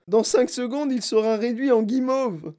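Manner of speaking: high, alarmed outburst; panicked protest. A narrator's reading. Mother fears marshmallow fate, panicked. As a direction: predict disaster, pitched right up.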